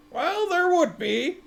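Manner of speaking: a hint of a hesitant woman, slow and high; lightly voiced unsure woman; uncertain woman. A narrator's reading.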